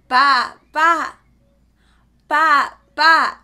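loud bleating baa; sheep baa. Shown as a demonstration. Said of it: "A sheep says".

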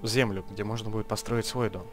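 ordinary delivery

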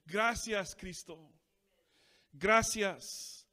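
loud, driving Spanish pulpit preaching; fervent preaching. Acted. Pastor exhorting the congregation in Spanish, fervent and loud. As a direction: press the church to return and give thanks.

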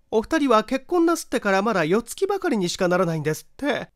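high, bright-voiced chatty housewife; gossipy neighbour wife. Neighbour wife eagerly gossips to the visitor, high chatty voice.

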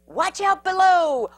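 loud, high warning cry; a panicked heads-up; alarmed shout. Acted. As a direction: yell it up from below. Ball crashes into burrow.

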